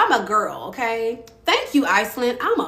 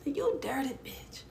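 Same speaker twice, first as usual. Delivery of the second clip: muttered insult; 5 semitones higher, 10 dB quieter than usual. Retold or imitated. Mimics reaction to being hung up on.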